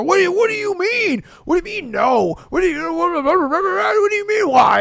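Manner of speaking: high-pitched mock outrage, sputtering and repeating; pissed-off customer. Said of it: "that would piss them off even more".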